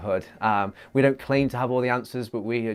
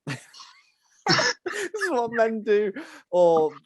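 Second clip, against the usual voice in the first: laughing; 9 semitones higher, 3 dB louder, much slower than usual. Real, not acted. Reading out ick list.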